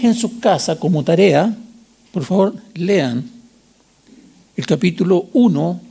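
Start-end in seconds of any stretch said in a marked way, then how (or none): none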